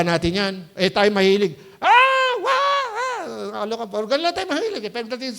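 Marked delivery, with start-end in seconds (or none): 1.8-3.6 mock panic shrieks. Mocking people who panic.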